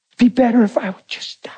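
voiceless whisper of a wife who wants to give up; whispered despair. Retold or imitated. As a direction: breathe the words out with no voice. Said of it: "That's what she would say".

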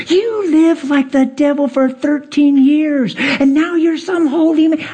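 imitating the angry wife jeering; mocking wife. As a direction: mimic her scornful voice. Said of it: "She said".

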